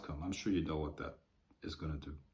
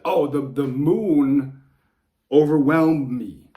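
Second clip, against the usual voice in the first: much louder, slow mocking whine; mock whiny sun; 3 semitones higher, 9 dB louder, slower than usual. Mocking the idea of the sun whining about the moon, slow and loud. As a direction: ham up a feeble complaint, slow and loud. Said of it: "how ridiculous would it sound if the sun said".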